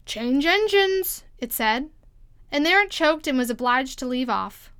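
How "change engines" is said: high, slow hoarse croak; a strange hoarse stranger on the train. A narrator's reading. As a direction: croak it out slowly, high in the throat. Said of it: "a hoarse voice spoke next".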